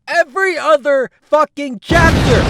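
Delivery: very high, very loud blast; furious high shout. Acted. Jung explodes at the fan, very high furious shout. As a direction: explode with exasperation.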